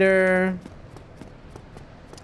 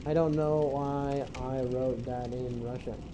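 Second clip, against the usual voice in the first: "I don't know why I wrote that in Russian" muttered slowly under the breath; slow quiet mutter; 3 semitones lower, 10 dB quieter than usual. Shown as a demonstration. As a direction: repeat it softer and slower, to yourself.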